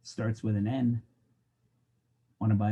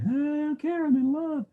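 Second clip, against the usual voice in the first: much higher dreamy cartoon voice; lovestruck Bambi. Bambi answers the hunters with guns, dreamy. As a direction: float it out, blissfully oblivious.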